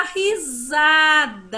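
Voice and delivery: "risada" loud, high and drawn out with a buzzing Z. Shown as a demonstration. Teacher naming what she just did, long Z in "risada". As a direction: stretch "risada", voicing the S as Z. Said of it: "som de Z".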